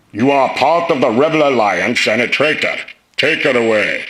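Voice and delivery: menacing Vader through a vocoder; Darth Vader voice. Shown as a demonstration. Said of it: "Darth Vader vocoder circuit".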